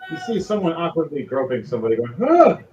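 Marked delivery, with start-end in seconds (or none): 2.2-2.6 short high, loud yelp; startled yelp. Acted. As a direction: yelp as if suddenly grabbed. Groped person cries out.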